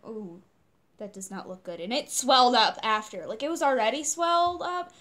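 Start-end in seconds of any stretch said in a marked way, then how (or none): 0.1-1.8 very soft, low aside; sheepish dismay. Retold or imitated. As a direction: murmur it low, guilty at the damage. Acts sheepish look at mom's hand.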